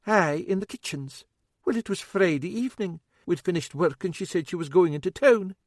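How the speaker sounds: fussing, a wee bit worried cook; older woman, worried. A narrator's reading.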